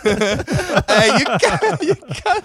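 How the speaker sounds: high, hammed-up Italian protest; mock Italian accent. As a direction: plead like a scandalised comic Italian, half laughing. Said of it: "little old Naples at the end".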